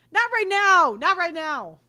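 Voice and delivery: loud, annoyed protest; sudden outburst. Real, not acted. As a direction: snap back, shut it down. Refusing to be rated, outburst.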